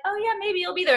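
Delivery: a lightly mocked noncommittal shrug; doubtful mock reply. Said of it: "part of me was like".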